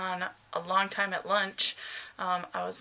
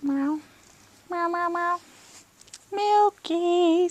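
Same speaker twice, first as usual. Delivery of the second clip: meowing at cat; 10 semitones higher, 6 dB louder, slower than usual. Real, not acted.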